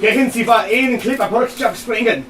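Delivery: loud put-on German accent; mock German accent. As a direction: bark it in fake German. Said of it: "show me your Russian accent".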